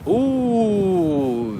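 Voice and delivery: long high "ooh" gliding downward; drawn-out, high-pitched coo of admiration; admiring ooh. Real, not acted. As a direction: swoon over it with a long ooh. Admiring a luxury display while walking.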